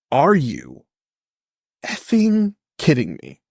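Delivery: drawn-out, incredulous emphasis, rising on effing; acted disbelief. Reacting to Goku listed under genetics, incredulous. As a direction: stretch it out in mock outrage.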